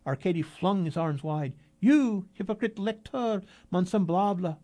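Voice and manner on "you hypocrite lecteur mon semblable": grand proclaiming. A narrator's reading. Arkady proclaiming verse.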